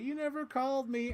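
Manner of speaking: high melodramatic reproach; lightly hammed soap opera line; soap opera heroine.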